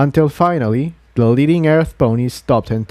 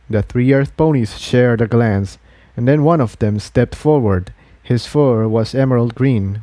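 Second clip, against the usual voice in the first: ordinary delivery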